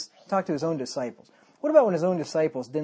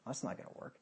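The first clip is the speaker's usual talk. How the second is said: whispered aside; 5 semitones lower, 17 dB quieter, faster than usual. Retold or imitated. Whispering priest plots quietly among his fellows. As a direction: drop to a whisper, conspiring.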